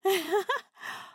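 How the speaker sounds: high, loud bursting laugh; gleeful open laugh; delighted laugh. Acted.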